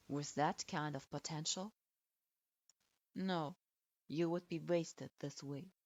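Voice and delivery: deep, cool authority pressing his point; stern lord voice. Acted. Stern man dismisses her.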